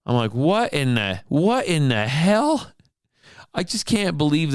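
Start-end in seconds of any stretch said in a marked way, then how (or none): 0.3-2.7 slow, high, drawn-out what in the hell; a man stunned at the gun counter; incredulous outburst. Retold or imitated. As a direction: disbelief stretched out. Reacting to gun aimed nearby.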